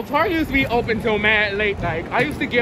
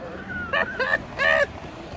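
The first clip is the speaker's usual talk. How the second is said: high laugh. Real, not acted. Runs over stranger with bag.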